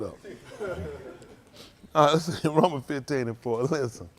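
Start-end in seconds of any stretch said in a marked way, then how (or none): none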